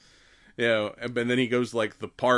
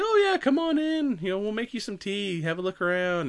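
high-pitched, mock-sweet old lady; welcoming old lady; 10 semitones higher than usual. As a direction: invite them in with a high sing-song lilt. Acted old lady inviting her in.

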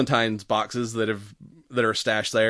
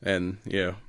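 usual speaking voice

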